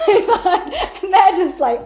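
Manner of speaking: words pushed out through laughter; slow, laughing as she talks; real laughter on the words. Real, not acted. Laughing while telling.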